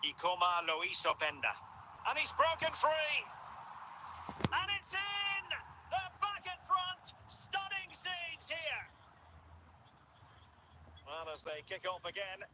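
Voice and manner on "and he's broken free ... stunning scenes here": high-pitched, stretched-out commentary cry; excited goal call. Real, not acted. Commentator calling a breakaway goal, excited. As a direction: let the voice soar, drawing out the words.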